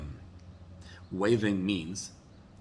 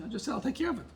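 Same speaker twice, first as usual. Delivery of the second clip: higher, put-upon husband voice, hammed-up; mock husband; 14 semitones higher, faster than usual. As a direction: play the defensive husband, pitched up. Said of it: "He says".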